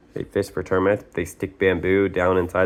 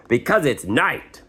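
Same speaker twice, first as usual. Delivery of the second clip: snapped; 6 semitones higher than usual. Acted. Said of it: "snapped Jim".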